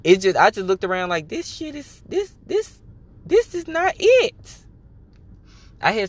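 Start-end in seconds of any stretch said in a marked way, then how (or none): 1.3-4.6 high-pitched, drawn-out exasperation; halting high disbelief that builds louder; exasperated realization. Retold or imitated. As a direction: stretch it out high, ending loud and fed up. Acted exasperated reaction to surroundings.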